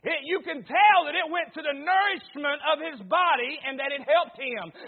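normal talking voice

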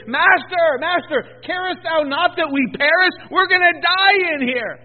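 high, loud, frightened plea; panicked cry. Acted. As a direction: cry out in terror of drowning. Disciples cry to Jesus in storm.

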